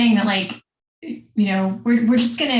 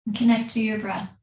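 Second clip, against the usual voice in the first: calm, unhurried yoga teacher voice with long pauses; guided meditation. Acted. Leads seated breathing meditation.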